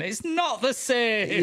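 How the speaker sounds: slow, stressed on not; slow, insistent northern protest; northern insistence. Real, not acted. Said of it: "he says. Listen to yourself".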